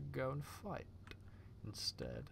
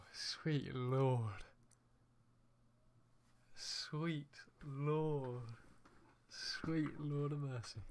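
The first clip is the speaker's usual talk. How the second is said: drawn-out disbelief; much slower than usual. Real, not acted. Recovering from laughing fit.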